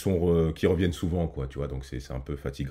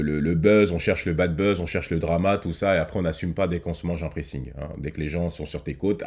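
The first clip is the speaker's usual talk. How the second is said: ordinary talk, nothing marked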